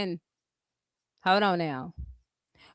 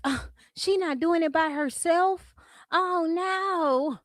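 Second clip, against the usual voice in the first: high, put-on scandalized voice; mock disapproval; 5 semitones higher, 4 dB quieter, slower than usual. Imitating critics shocked she isn't doing it alone, mock disapproval. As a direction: mimic a critic who is appalled, higher pitched.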